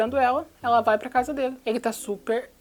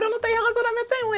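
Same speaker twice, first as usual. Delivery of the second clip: mock high voice; 12 semitones higher, faster than usual.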